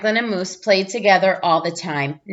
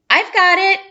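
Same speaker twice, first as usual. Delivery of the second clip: much higher, louder eager shout; cried out; 11 semitones higher, 5 dB louder than usual. A narrator's reading. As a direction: call it out eagerly, reaching for the ball. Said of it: "cried Moose".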